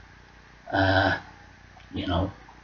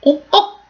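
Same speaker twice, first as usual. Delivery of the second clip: mock click sounds; 5 dB louder than usual. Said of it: "click tongues are like".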